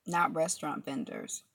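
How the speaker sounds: ordinary delivery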